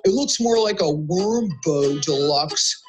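slow, drawn-out mocking; tease, stretching the silly name; teasing. Buster teasing Carla about her sandwich, slow mocking drawl. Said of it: "teased Buster".

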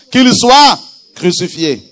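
loud, slow crowd cry, read aloud; cri plus fort. A narrator's reading. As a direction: cry it out slowly as the crowd's demand. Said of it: "il cria encore plus fort en disant". (cri de la foule, fort et appuyé)

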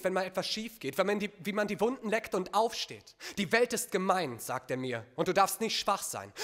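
poetry slam recitation; spoken-word poem recital. Acted. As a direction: perform it as an intense personal poem, building then falling away.

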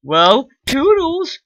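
mock farewell. Sends hero to dungeon mockingly.

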